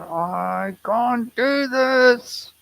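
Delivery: very high, loud and very slow cry of frustration; reliving the moment he snapped at the teacher; screamed. Retold or imitated. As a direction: let it burst out as a strained scream. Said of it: "And then I screamed".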